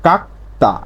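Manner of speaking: কাকতা split into "kak" and "ta", with a voiceless t; corrected t reading. Shown as a demonstration. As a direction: model কাকতা syllable by syllable, t not d. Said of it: "তখন উচ্চারণটা হবে কি?".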